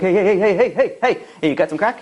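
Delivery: rapid-fire heys from a twitchy addict; hopped-up man hustling on the street. Acted. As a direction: pester a stranger like a jittery junkie. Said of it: "scratching himself very hard, and he's like".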